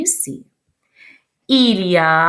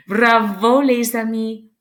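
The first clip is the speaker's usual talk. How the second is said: ordinary delivery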